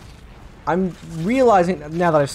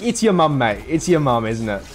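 lightly imitated British accent, cheeky; a hint of cheeky British banter; British quiz guest; 2 semitones lower than usual. Quiz show guest teasing the host in a mild British accent. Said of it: "went on about it".

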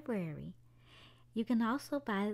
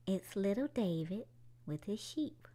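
gentle storybook narration for little ones; children's story time read-aloud. A narrator's reading. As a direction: tell it like a bedtime story. Reads a picture book aloud.

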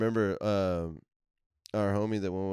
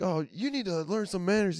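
higher prissy scolding voice; mock scolding old man; 11 semitones higher than usual. Old Pirates fan scolding the rowdy kids, prissy high voice. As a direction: lecture them primly.

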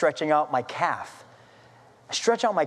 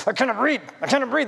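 retold breathless panic; 5 semitones higher, faster than usual. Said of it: "I couldn't even speak".

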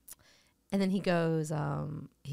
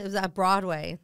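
ordinary talk

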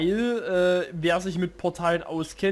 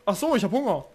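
ordinary talk

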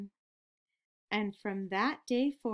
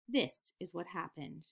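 usual speaking voice

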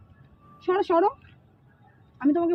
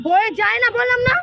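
high, exasperated retort; heated, raised-voice contradiction; angry insistence; 8 semitones higher, 4 dB louder than usual. Real, not acted. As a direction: argue back with voice pitched way up. Contradicting him in quarrel.

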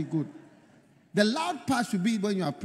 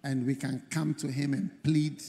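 leading prayer; 5 semitones lower, 6 dB quieter than usual. Real, not acted. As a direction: usher the congregation gently into prayer. Leads congregation into prayer.

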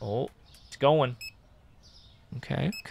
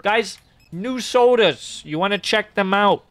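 calling out to customers; 5 semitones higher, 7 dB louder than usual. Acted. Advertising sodas to customers.

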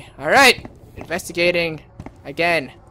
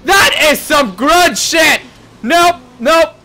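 high, loud, emphatic refusal; real spooked outburst; startled shout; 7 semitones higher, 9 dB louder, slower than usual. Real, not acted. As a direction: shout it in alarm, punching each word. Startled by creepy horse in horror game.

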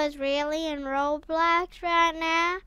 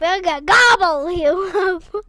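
bellowed monster threat, loud; gleeful roaring gobble threat; loud playful threat; 9 dB louder than usual. Pretend. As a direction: shout it like you're about to pounce. Playful threat to other player.

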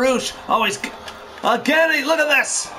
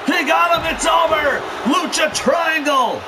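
excited live play-by-play, raised voice; wrestling commentator; 3 semitones higher than usual. Real, not acted. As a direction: call the action as it happens.

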